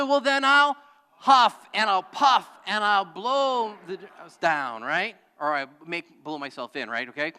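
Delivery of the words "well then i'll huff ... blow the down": slow, loud, menacing huff and puff; big bad wolf threat. The wolf threatening the pig, slow and loud. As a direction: drag out each threat like a bully. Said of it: "So the wolf responded. He said".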